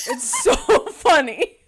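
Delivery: laughing loud and high through the words. Real, not acted. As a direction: breaks into a real laugh mid-line. Recalling the groomsmen photos.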